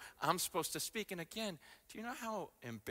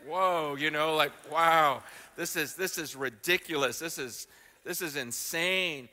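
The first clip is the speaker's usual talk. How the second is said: louder, wide-eyed amazement; loud amazed awe; 9 dB louder than usual. Retold or imitated. Pastor recalling persecution stories, loud astonished awe. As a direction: let the wonder burst out loud.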